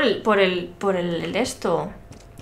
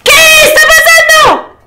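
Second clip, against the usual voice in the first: real high, very loud panicked shriek; terrified scream; 15 dB louder than usual. Real, not acted. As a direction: scream it in sudden fright. Jump scare in horror game.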